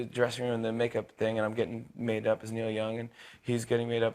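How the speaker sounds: usual speaking voice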